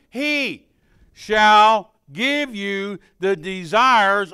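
loud, slow, stretched pulpit reading; preaching at full force. Acted. Preacher reads verse four to the congregation, loud and stretched. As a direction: preach the promise out to the room.

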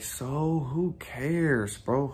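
drawn out slow and soft, shrugging it off; dismissive. Pretend. Vlogger showing viewers how to shrug off flaws, slow. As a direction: let it roll out slowly, unbothered. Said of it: "Or I could be like".

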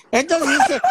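ordinary talk, nothing marked

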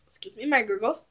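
usual speaking voice

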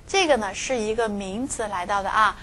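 normal talking voice

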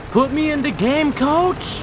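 high, loud eager call; excited plea. Real, not acted. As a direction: plead to get in the game. Begging to play, gaming.

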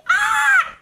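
high-pitched squeal of disgust; disgusted scream. Real, not acted. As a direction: shriek at a gross sight. Reacting in disgust to a pimple.

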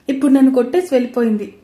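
plain reading voice

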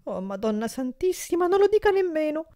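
high, loud sobbing cry; sobbing plea. A narrator's reading. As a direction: cry out through sobs. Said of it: "singhiozzò il povero berretta".

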